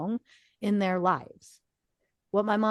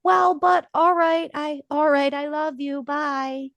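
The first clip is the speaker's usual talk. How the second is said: high, loud, caught-off-guard mom; mock flustered goodbye, pitched up; 7 semitones higher, 4 dB louder than usual. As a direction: sputter like the cut-off grandma. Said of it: "And my mom goes".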